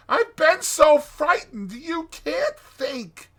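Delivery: high, slow, shaken plea; frightened. A narrator's reading. Trembling Mole telling Rat how scared he was. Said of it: "exhausted and still trembling".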